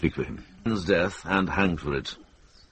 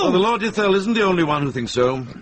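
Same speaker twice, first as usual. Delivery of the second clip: higher, louder and fast, cutting in; urgent interjection; 11 semitones higher, 4 dB louder, faster than usual. Acted. Monk raises his voice over the lords' uproar. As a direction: rush in to calm the room. Brother cuts in over the clamour.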